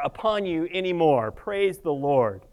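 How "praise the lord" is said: usual speaking voice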